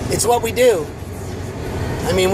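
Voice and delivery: ordinary talk, nothing marked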